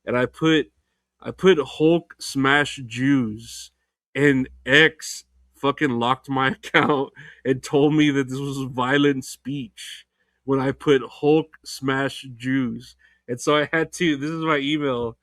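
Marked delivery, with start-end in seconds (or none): 1.6-3.7 each word drawn out very slowly; slow quoted tweet. Retold or imitated. Quoting his own banned tweet. 11.0-13.0 each word drawn out very slowly; slow quoted tweet. Retold or imitated. Quoting the banned tweet again.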